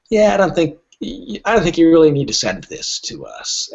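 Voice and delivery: lightly voiced dismissive website notice; a breezy brush-off, barely acted; mock website voice. Agent mocking his old website wording, lightly dismissive. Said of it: "where it said".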